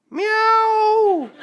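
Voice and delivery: very high, loud mock meow; cat meow. Hidden cat Pickles calls out, high loud meow.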